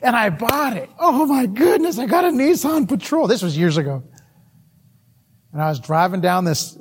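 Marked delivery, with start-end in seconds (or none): 1.0-3.3 high, mock-thrilled exclamation; excited. New owner marvelling at his Nissan Patrol, excited. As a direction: play up the delight of a new owner. Re-enacts joy at buying car.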